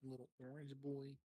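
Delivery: teasing high-pitched mimic; squeaky mocking repeat. Teasing repeat of friend's words.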